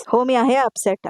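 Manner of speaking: usual speaking voice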